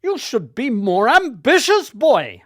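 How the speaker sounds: booming Welsh reproach; Welsh teacher voice. Retold or imitated. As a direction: push the boy hard in a Welsh accent. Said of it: "in his Welsh voice".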